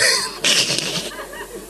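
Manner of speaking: a long wheezy high-pitched laugh; breathless laughter. Real, not acted. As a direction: laugh hard at your own joke. Laughs at joke on salaries.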